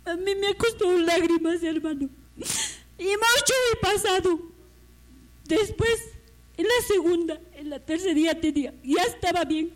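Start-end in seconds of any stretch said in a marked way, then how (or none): 0.1-4.4 real tears. Real, not acted. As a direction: voice breaking into crying. Tearful testimony of past suffering.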